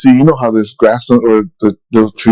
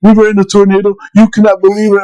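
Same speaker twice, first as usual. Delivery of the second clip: put-on excited kid; 8 semitones higher, faster than usual. Son tells mom about tornado.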